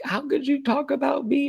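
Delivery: high, whiny imitation of a hurt woman; mock hurt whine. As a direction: squeak it out like she's wounded. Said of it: "she let her be like".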